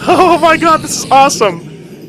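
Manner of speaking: thrilled, high and loud; yelling with glee as they swarm; excited. Real, not acted. Pigmen swarm him, thrilled.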